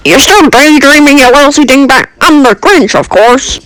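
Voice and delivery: high-pitched, mocking villain; taunting Grinch. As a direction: sneer as the Grinch introducing himself.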